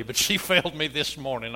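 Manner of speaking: playful laughing gripe. Real, not acted. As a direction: chuckle as you repeat the complaint.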